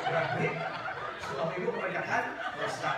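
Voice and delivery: cracking up at the joke; laughing loudly. Real, not acted. (tertawa keras sambil bicara)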